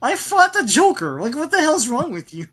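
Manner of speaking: high indignant mock protest; mock outraged Batman.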